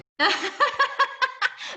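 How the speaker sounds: high-pitched burst of laughter; amused laugh. Real, not acted. Teacher hears the student's exam story, laughing.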